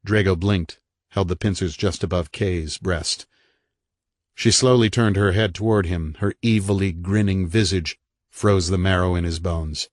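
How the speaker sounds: ordinary delivery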